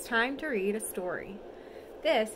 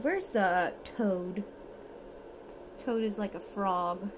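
ordinary delivery

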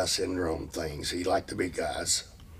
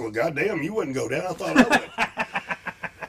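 laughing retold reply; 7 semitones higher, slower than usual. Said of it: "I said".

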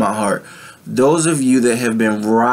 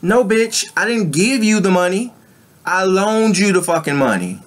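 mock indignant retort, pitched higher; sassy retort; 8 semitones higher than usual. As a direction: snap back at someone who forgot the loan. Acting out a money lender's retort.

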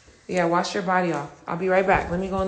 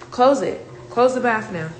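firm command; 4 semitones higher, 7 dB louder than usual. Real, not acted. Mother ordering her daughter in the bath, loud. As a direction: tell her firmly to close the bath.

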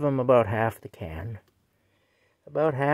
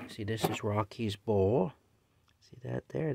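ordinary delivery

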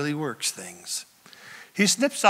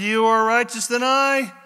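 high, loud declaration; declaimed quote; 7 semitones higher, 5 dB louder than usual. Retold or imitated. Saul admitting to David, declaimed loudly. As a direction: proclaim it loudly, pitched up. Dramatic reading of Saul, then corrected.

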